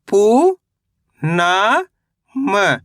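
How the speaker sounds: पू and ना long and rising, then short म; sound by sound. Shown as a demonstration. Teacher breaking a word down for learners, syllable by syllable. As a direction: sound out पू ... ना ... म with pauses. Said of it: "तो मिलके बन जाएगा".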